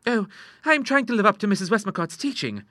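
put-on high-pitched girlish protest; earnest daughter. A narrator's reading. Ida quoting her book to her father, earnest. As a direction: argue earnestly in a high young voice.

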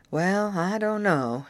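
drawn out slowly, weighing it; a slow, doubtful musing; slow and hesitant. A narrator's reading. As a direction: let each word hang, unsure what to offer. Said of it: "said Mister Belton slowly".

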